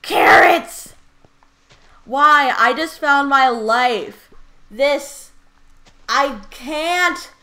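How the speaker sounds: loud, high cry of overwhelmed joy; excited screaming. Real, not acted. Player finds carrots at last, excited screaming. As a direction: scream it out, stretching words in disbelief. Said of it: "sorry for all my screaming".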